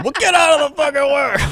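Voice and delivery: high, loud hammed-up yell; mock shout. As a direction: blast it back like an exasperated parent. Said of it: "mock parent retort".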